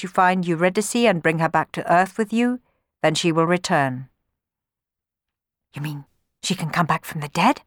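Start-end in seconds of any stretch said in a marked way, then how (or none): 5.7-7.6 a lightly voiced amazed question; mild astonishment, hardly above the narrating voice; understated disbelief; amazed gasp. A narrator's reading. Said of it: "gasped Orpheus, amazed".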